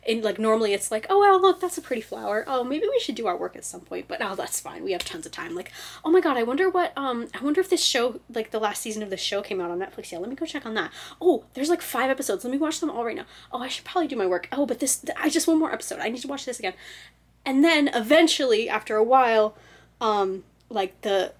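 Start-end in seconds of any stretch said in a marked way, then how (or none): 1.1-5.5 breezy, distracted self-talk that settles into nonchalance; carefree inner voice. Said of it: "the little voice in your head goes from". 6.0-16.7 higher-pitched, eager self-talk; bubbly procrastinator's chatter; distracted inner voice. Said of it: "the little voice in your head goes from".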